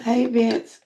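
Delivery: ordinary talk, nothing marked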